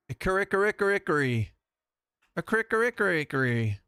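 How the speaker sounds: sing-song a nonsense word for fun; silly voice. Pretend.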